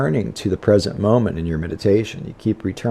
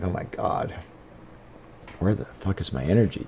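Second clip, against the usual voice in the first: breathy, spent whisper of frustration; drained and muttering to yourself; exhausted whisper; 2 semitones lower, 11 dB quieter than usual. Retold or imitated. On a bad yoga day, whispering in exhausted frustration. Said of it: "I'm like".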